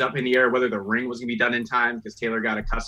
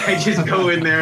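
laughing; 6 semitones higher, 6 dB louder than usual. Real, not acted. Laughing about hype man role.

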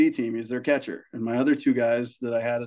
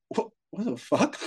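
stunned disbelief; 7 semitones higher, 4 dB quieter than usual. Retold or imitated. Reacting to dad's refusal.

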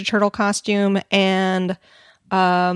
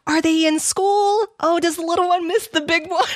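syrupy clerk voice, pitched up; cashier's sweet questions; 7 semitones higher than usual. Retold or imitated. Cashier cooing questions about the imagined kids. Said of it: "she's like".

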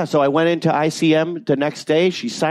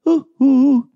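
high, loud, hooting "ooh, ooh"; Frozen shopkeeper's call; 12 semitones higher, 6 dB louder than usual. Acted. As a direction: call out in a high put-on character hoot. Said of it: "the guy in Frozen who runs the shop".

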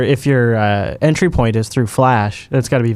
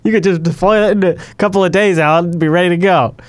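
ordinary talk, nothing marked

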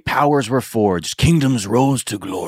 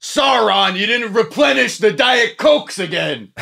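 nagging parent; 11 semitones higher than usual. Retold or imitated. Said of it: "They're like".

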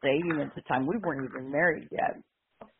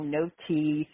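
ordinary delivery, nothing marked

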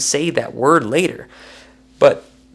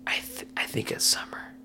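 unsure whisper, far softer; whispered aside; 16 dB quieter than usual. Real, not acted. To listeners, an unsure whispered aside about the wife's name. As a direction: breathe it as a private doubt.

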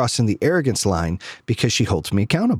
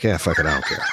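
careless shrug; 10 semitones lower than usual. Retold or imitated.

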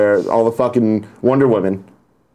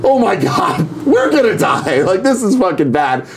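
high, panicked cry through a laugh; 7 semitones higher than usual. Retold or imitated. Friends trapped at the wall, panicking, high mock fear. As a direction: squeal it in mock terror. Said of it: "And we're like".

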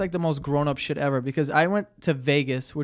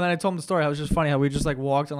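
normal talking voice

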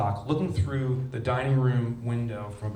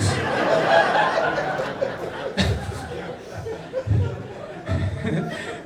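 long, loud, high burst of laughter; big laugh; 5 dB louder than usual. Real, not acted. Speaker to audience, cracking up at the bullshit line. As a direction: let the laugh roll out at your own punchline. Laughing at his own joke.

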